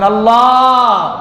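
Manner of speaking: long drawn-out, melodious "Allah"; sweet, slow devotional call; tender, loving call. Shown as a demonstration. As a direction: call out to God with love, holding the last vowel. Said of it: "মায়াবী কণ্ঠে ডাকবেন".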